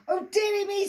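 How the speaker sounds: high, loud shriek; screamed. Acted. Emma shrieking at Billy, high and loud. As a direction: shriek it like a horrified witch. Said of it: "screamed Emma".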